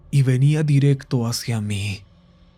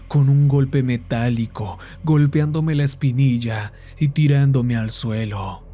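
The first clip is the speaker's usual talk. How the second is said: plain reading voice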